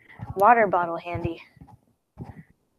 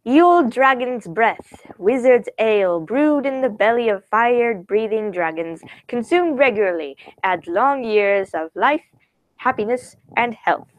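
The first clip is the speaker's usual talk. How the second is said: grand, drawn-out proclamation, pitched up; dramatic label reading; 3 semitones higher than usual. Acted. Reading the Wizard's Ale label to viewers, grandly proclaimed. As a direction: read it out like a fantasy advertisement. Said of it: "I'll read it to you".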